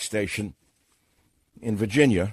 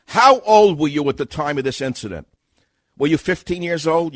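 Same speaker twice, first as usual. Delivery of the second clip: firm, raised investigator's questioning; a louder, higher cross-examining voice; prosecutor questioning; 4 semitones higher, 6 dB louder than usual. Acted. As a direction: put pointed questions like an investigator. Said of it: "I'm an experienced sex crimes investigator".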